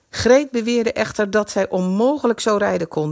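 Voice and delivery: ordinary delivery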